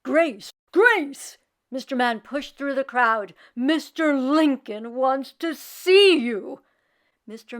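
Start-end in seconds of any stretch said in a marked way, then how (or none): 0.0-1.4 loud rising calls across a crowd; calling out. Acted. Calling her name in crowd. 3.5-6.6 urgent announcement. Acted. Mister Man summons her.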